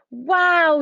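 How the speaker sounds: loud, high yell of wonder; excited yell. A narrator's reading. Max spots huge hot air balloons, yelling high. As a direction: burst out at the sight. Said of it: "yelled Max".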